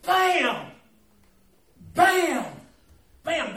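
loud, high punched bams; boat slamming sound. Retold or imitated. Preacher to the congregation about the boat, loud bams. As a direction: slam each one out hard.

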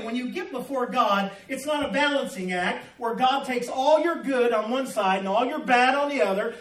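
ordinary talk, nothing marked

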